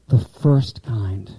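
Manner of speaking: slow emphasis. Shown as a demonstration. Teacher stressing the first love to class, slowly. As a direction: drag out "The first kind" for weight. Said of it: "And watch the emphasis".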